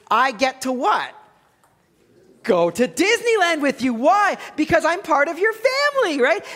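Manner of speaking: high, giddy mock begging; excited pleading. Preacher playfully asking kids to adopt him, excited pleading. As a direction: pitch it way up, pressing the kids eagerly.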